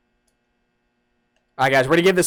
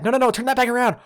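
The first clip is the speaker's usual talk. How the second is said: ordinary talk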